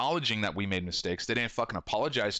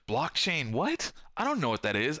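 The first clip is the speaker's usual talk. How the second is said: rising, bewildered, much higher pitch; baffled; 8 semitones higher than usual. Retold or imitated. Shop owner baffled by a friend's Bitcoin pitch, squeaking up in confusion. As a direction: play clueless about crypto, voice climbing. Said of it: "I'm like".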